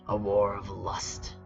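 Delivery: normal talking voice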